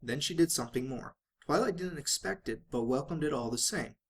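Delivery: ordinary delivery, nothing marked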